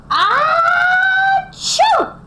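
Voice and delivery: a big, loud, high achoo; huge sneeze. Acted. Teacher acting the bear's sneeze for the kids, a big loud achoo. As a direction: sneeze it out like a giant bear.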